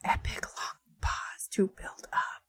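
hushed, dramatic whisper. Acted. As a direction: whisper to build suspense. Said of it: "you whispered so quietly".

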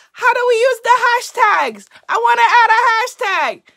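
high, loud Guyanese accent impression; mom's voice in a thick Guyanese accent. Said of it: "a terrible Guyanese accent".

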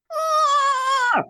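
a very high mock shriek of frustration; angry frustrated shriek. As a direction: let out a short hammed-up squeal of annoyance. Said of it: "I was so angry".

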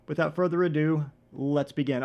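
normal talking voice

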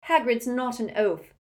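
high, loud indignant protest; shrill. A narrator's reading. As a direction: object shrilly in defence of a friend. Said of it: "said Hermione shrilly".

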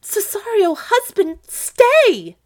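high, loud desperate call; crying aloud. Acted. Olivia in great fear to her husband, crying aloud. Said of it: "she cried aloud".

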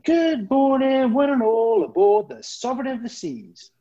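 high, drawn-out loud announcer call; mock ship announcement. Drunk crewman hijacking the ship's morning announcement, mock grand announcer voice.

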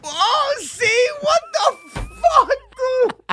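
high-pitched shriek of laughing disbelief; shocked outburst. Real, not acted. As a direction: squeal it loudly in real, amused shock. Reacts to shocking oil confession.

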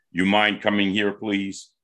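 understated, composed voice of authority; authoritative voice. Shown as a demonstration. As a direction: politely give an order, slightly low. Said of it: "that's my authoritative voice".

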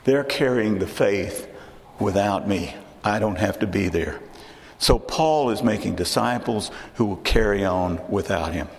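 usual speaking voice

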